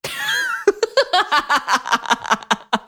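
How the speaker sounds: long helpless laugh; burst of laughter. Real, not acted. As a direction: laugh it out, high and breathless. Laughing at the suicide twist.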